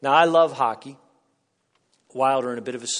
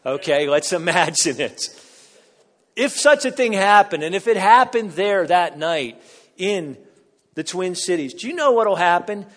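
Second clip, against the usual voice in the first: loud, rising sermon voice; preaching at full force; 7 semitones higher, 10 dB louder than usual. Acted. Pastor urging the congregation about joy, loud preaching. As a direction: pound it home from the pulpit.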